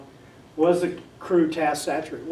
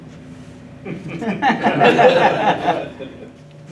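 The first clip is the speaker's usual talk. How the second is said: big hearty laugh, louder; long laugh; 6 semitones higher, 8 dB louder than usual. Real, not acted. Speaker laughs at old pilot's boast, loud and long. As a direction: laugh out at the punchline.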